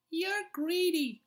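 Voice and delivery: high, slow taunting squeak; cheeky mouse. A narrator's reading. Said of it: "said a cheeky outdoor mouse".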